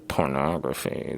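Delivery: mimicked "pornography"; imitating his pronunciation. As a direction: say "pornography" the way Sean does. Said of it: "the way he says it".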